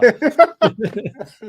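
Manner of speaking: sudden high snort of laughter. Real, not acted. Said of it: "that was a snort".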